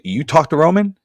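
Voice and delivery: surprised echo. Retold or imitated. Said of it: "and Paul's like".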